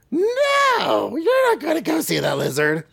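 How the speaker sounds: put-on high old-auntie voice; Aunt May voice. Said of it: "Aunt May voice".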